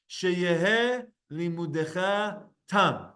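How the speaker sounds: drawn out, each syllable clear; slow, careful reading. Shown as a demonstration. Teacher reading the phrase slowly for students. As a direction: read it out slowly for the class. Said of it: "a contraction of two words".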